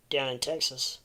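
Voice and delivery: usual speaking voice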